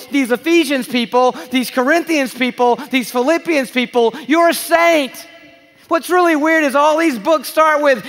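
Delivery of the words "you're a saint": slow declared shout; triumphant sermon shout; proclaiming shout. Real, not acted. Preacher proclaiming identity.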